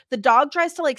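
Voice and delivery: ordinary talk, nothing marked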